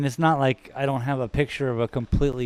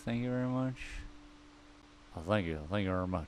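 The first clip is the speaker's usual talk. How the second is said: [name] impression; 4 semitones lower, 9 dB quieter, much faster than usual. Streamer thanking chat in a bad mock [name] voice. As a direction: thank the crowd like [name], half-hearted. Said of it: "[name] impersonation".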